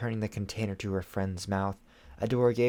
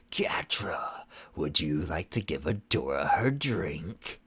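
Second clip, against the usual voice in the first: cold stern sorceress; 4 semitones lower, slower than usual. Acted. Shadow Weaver sweetly threatening.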